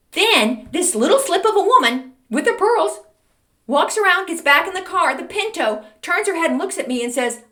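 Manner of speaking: high, loud, excited build-up; incredulous storytelling. Real, not acted. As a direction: tell it in high-pitched disbelief.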